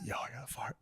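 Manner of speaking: whispered secret to a friend. Retold or imitated. Buddy whispering a warning in class. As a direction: hush it under your breath. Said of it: "he was like".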